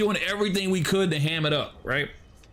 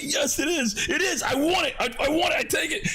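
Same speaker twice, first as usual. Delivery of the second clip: much higher, pleading insistence; a friend grabbing the milk he was refused, insisting high and whiny; insistent whine; 9 semitones higher than usual. Retold or imitated. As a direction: demand it like a stubborn kid.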